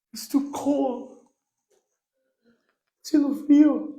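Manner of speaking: high, slow whining complaint; whiny excuse. Retold or imitated. Churchgoer making an excuse about the cold, high and whining. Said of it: "No ponga excusa de que".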